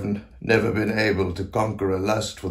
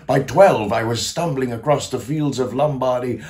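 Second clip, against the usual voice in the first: higher, swept-up telling; rapturous recollection; 4 semitones higher than usual. Acted. Composer recalls boyhood love of music, rapturous. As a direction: let the memory carry the voice upward. Rapturous memory of youthful passion.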